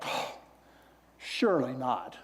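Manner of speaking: mock offended disbelief. Priests reacting to Jesus' parable, in hushed indignant disbelief. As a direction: play the put-off priests, understated. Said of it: "They were mad".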